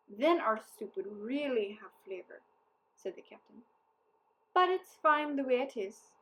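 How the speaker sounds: a wily soldier teasing the townsfolk; sly captain, scheming. A narrator's reading. Captain figure voiced in story.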